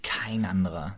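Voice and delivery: dropped to a hushed whisper; awed whisper. Real, not acted. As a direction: whisper it like a reverent secret. Emphatic praise of actor.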